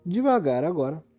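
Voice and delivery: ordinary talk, nothing marked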